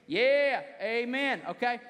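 loud rallying call to the crowd. Real, not acted. Preacher calling out to the congregation, loud and rousing.

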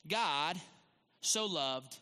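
measured phrases with pauses, opening strong, softening at the end; deliberate verse reading. Real, not acted. Pastor reading the verse to the church, deliberate phrases. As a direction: read scripture out phrase by phrase. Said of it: "Let me read it to you again".

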